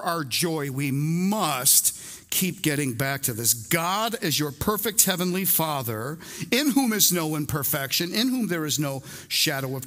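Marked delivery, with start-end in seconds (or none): none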